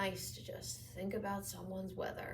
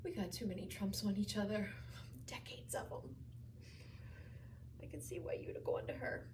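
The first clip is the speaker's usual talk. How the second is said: very soft, mostly whispered confession; faint, weary near-whisper; hushed regret; 3 semitones lower, 13 dB quieter, faster than usual. Acted. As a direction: barely voice it, with long pauses. Patient's regretful confession to visitor.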